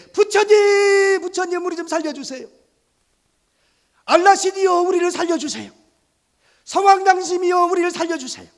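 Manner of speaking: high, loud wailing prayer; desperate pleading. Acted. Frightened sailors wail to their gods in the storm. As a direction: beg each god to save them.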